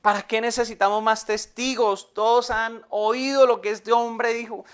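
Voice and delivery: loud, high cry of outrage; acted horrified outcry. High priest condemning Jesus before witnesses, loud horrified outcry. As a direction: cry out in horror, high and forceful. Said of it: "en señal de horror, dijo".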